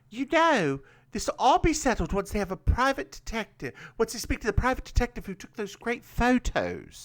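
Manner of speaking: high, quick put-on voice; Jess. Woman explains to George about the detective, high chirpy voice. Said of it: "And Jess like".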